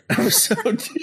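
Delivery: high, laughing through the words; breaking into laughter. Real, not acted. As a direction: crack up while announcing it.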